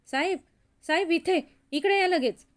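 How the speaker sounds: loud, high-pitched call to the boss; urgent shout. Acted. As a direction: shout out to summon him right away. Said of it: "संध्या जोरात ओरडला".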